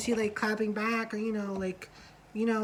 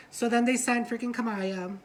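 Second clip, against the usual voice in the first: normal talking voice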